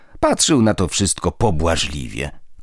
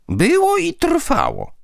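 "Było" pitched much higher, slow and firm; said forcefully; slower than usual. A narrator's reading. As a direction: hammer home "Było i trwało". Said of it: "powiedziała z mocą Dusia". (z mocą, wolno, "Było" wysoko i dobitnie)

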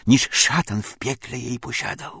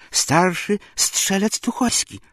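slow, high, halting report; scared soldier answering haltingly; choked, frightened reply; 9 semitones higher than usual. Acted. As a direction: get the words out through a tight throat. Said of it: "słowa z gardła zatkanego wydostać nie potrafił".

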